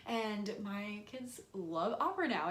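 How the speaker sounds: ordinary delivery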